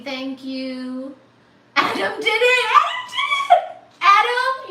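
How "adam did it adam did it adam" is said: high, loud triumphant cheer; real jubilant shout. Real, not acted. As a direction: celebrate him at full voice. Cheering for donor.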